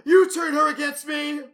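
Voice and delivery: high, loud, slow whiny shout; Anakin impression. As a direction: scream it like a petulant young Jedi. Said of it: "And then Hayden Christensen".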